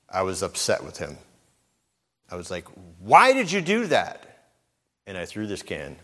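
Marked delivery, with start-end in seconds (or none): 3.0-4.5 high, loud, slow angry shout; retold angry outburst. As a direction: yell it at your son, exasperated. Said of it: "but in an expression of anger".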